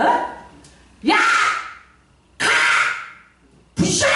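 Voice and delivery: explosive yelled command; battle shout. Shown as a demonstration. Preacher shows how to fight demons, explosive battle shout. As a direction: yell each word as a separate blow. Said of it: "이러지".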